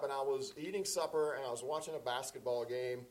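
ordinary talk

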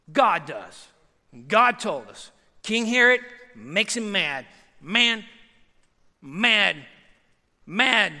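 ordinary delivery

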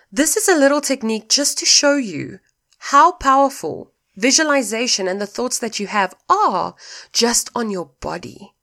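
ordinary talk

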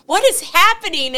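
high, loud cry of confusion; bewildered cry. Retold or imitated. Owner in parking lot as fire trucks arrive, bewildered cry. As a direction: blurt it out, baffled.

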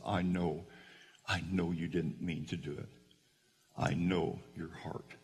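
soft, low, forgiving voice; tender reassurance. Retold or imitated. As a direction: console a crying child very gently. Teacher embraces crying boy, forgiving him.

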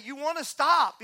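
usual speaking voice

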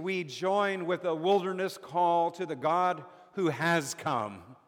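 normal talking voice

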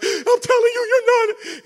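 high-pitched mock-crying preacher voice; Joel Osteen, tearful trembling. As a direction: imitate a preacher tearing up, pitch leaping high. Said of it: "And his voice trembles".

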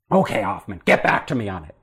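lightly voiced tough Hollywood producer; imposing boss. Producer putting the new hire on the spot, low and gruff.

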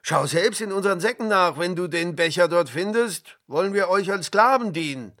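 loud, fast, heated protest; outraged, hurried challenge; indignant. A narrator's reading. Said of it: "riefen die Brüder empört".

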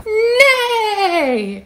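long, high storybook whinny; horse-like, drawn-out cry; mighty neigh. A narrator's reading. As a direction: stretch the word into a grand neigh. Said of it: "He neighs a mighty neigh".

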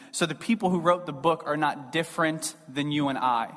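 ordinary talk, nothing marked

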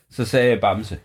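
usual speaking voice

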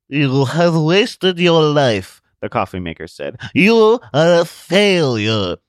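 high, loud, slow sneering gadget; talking coffee maker. Acted. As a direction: sneer it like a cruel machine.

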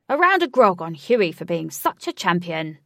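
loud rousing toast; cheering. A narrator's reading. Ajax to the party toasting Huey, loud cheer. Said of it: "cheered Ajax".

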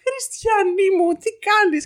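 high, slow, exasperated plea; aghast sister, acted. Sister confronting her mother at the kiosk, aghast retold plea. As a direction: stop her in horror, imitating the sister.

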